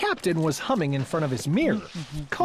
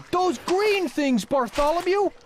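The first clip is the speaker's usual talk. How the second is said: higher, slow alarmed question; startled trumpeter; 8 semitones higher, slower than usual. Acted. Trumpeter sees the oobleck, slow startled higher voice. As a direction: stare at the green things in shock.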